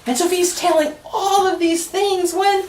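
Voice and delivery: lively, sing-song picture-book reading; reading to children. A narrator's reading. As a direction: read it aloud to a room of kids.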